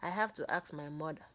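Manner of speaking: plain reading voice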